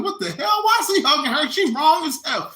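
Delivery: high, incredulous outburst; outraged disbelief. Retold or imitated. Viewer yelling at the talk show, high outraged disbelief. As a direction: squeal it in disbelief. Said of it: "you're like".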